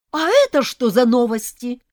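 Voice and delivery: loud, high-pitched demand; outraged yell. A narrator's reading. Bride seeing her dress worn, yelling. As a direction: burst out at the offender. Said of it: "заорала я".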